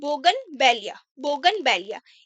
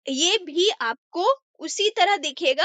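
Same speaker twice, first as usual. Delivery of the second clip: ordinary talk, nothing marked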